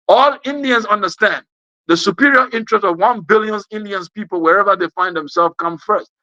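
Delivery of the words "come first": ordinary delivery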